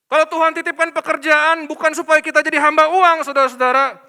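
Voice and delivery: loud, high sermon push; preaching at full force. Acted. Pastor warns workers against serving money, preaching loudly. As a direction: drive the point home loudly.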